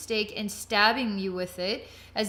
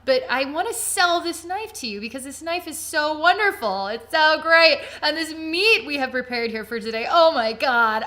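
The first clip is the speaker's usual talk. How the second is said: gushing, much louder TV pitch voice; cooking show seller; 5 semitones higher, 8 dB louder than usual. Pretend. Gushing knife seller pitching to the viewer, loud and excited. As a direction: sell the knife like a giddy shopping channel host. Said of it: "I feel like I'm on a cooking show".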